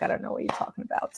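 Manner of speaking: quick whispered mock confusion from mom; mom's hushed, fast I-don't-know; whispered mom reply. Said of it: "And she's like".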